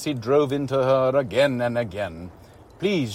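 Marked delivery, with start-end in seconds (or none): 2.8-3.1 begging. A narrator's reading. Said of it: "she begged".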